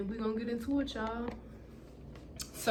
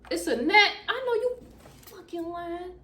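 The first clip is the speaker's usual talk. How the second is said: startled; 10 semitones higher, 3 dB louder than usual. Real, not acted. Spots a bug near food.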